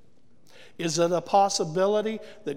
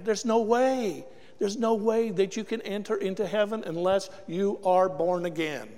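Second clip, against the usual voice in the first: ordinary delivery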